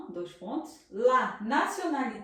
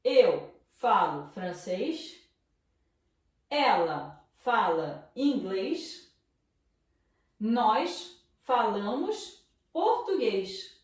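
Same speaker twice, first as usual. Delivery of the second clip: slow careful translation; much slower than usual. Shown as a demonstration. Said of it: "aqui traduzindo".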